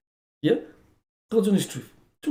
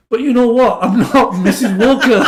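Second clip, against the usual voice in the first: high, loud protest breaking into laughter; exasperated outburst; 7 semitones higher, 9 dB louder than usual. Retold or imitated. Woman correcting the lawyer in court, mock-exasperated. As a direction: burst out indignantly, hammed up for the story. Woman finally objects to mistaken name.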